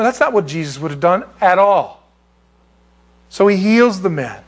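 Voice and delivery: normal talking voice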